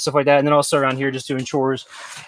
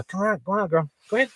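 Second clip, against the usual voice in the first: higher, soft dog-coaxing voice; 5 semitones higher, 5 dB quieter, faster than usual. Real, not acted. Camper urging the dog to come on, coaxing. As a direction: gently urge a pet along, pitched up.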